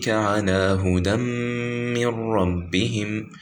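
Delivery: measured, chanted recitation of the verse; Quran recitation. Shown as a demonstration. Teacher reciting a verse for students, chanted.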